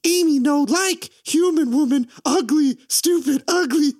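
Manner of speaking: squeaky caveman-style ape talk, high and loud; mock ape voice. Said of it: "she talks and she's like".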